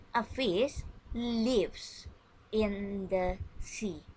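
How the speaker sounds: put-on each word slow and clear for children to repeat; slow teacher demonstration for kids; slow model sentence. Shown as a demonstration. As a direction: spell the sentence out slowly so a class can echo it. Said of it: "Listen and repeat after me".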